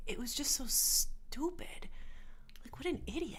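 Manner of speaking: soft whispered scorn. Real, not acted. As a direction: whisper it under your breath. Disgusted aside about the liar.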